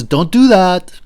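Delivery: a sudden loud warning, stopping him short; the conscience shouts the order; loud inner voice. Pretend. Inner voice warns Socrates off, loud. A voice that says.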